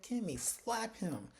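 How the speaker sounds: drawn out, teasing high urging; egging her on. Retold or imitated. Viewer urging Kimmy during the slap scene, mock command. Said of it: "I was like".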